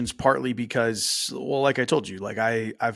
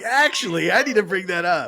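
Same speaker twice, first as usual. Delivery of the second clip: high, loud playful imitation; mock speaker voice; 10 semitones higher, 5 dB louder than usual. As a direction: tease her by voicing her. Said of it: "You're like".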